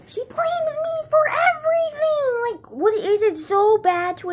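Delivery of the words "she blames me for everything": upset complaint. Real, not acted. Upset at grandma.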